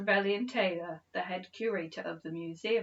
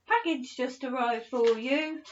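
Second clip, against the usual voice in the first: calling out; 5 semitones higher, 7 dB louder than usual. A narrator's reading. Caretaker calling out news.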